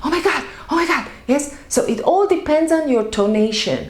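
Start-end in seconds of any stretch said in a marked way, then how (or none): 0.0-1.0 afraid. Shown as a demonstration. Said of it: "when you're afraid, you're saying".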